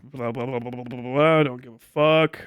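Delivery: usual speaking voice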